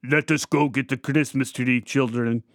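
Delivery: put-on father calling out in a heavy Hungarian accent. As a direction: roll the R's like a Hungarian dad rounding up the kids. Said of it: "He yelled out in his heavy accent".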